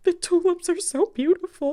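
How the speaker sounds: high-pitched mock tearful wail; retold sobbing. Telling son why she cried on the walk, mock sobbing. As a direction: imitate yourself sobbing, pitched far up. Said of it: "I start sobbing on this walk".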